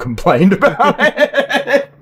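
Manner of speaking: words broken by a laugh. Real, not acted. Laughing at his own story.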